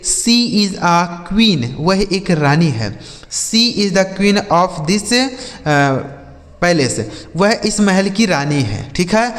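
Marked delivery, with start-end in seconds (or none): 0.0-1.7 slow example reading. Shown as a demonstration. Teacher reads example sentence slowly. 3.3-7.1 slow example reading. Shown as a demonstration. Teacher reads example sentence slowly.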